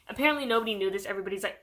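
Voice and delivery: usual speaking voice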